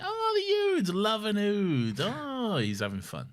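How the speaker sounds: high, delighted cooing; the Doctor. Retold or imitated. Imitating the Doctor delighted by the Ood, cooing. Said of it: "And the Doctor's like".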